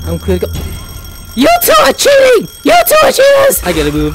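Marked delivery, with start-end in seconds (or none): 1.4-3.6 high-pitched indignant yell; high, protesting cry; outraged accusation. Real, not acted. Accuses two players of cheating.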